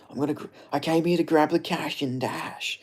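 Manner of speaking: whispered, altered fighter voice; breathy whispered take of the fighter's line. Shown as a demonstration. Streamer retries the catchphrase as a whispered Jack Della Maddalena voice. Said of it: "I don't know how to describe his accent".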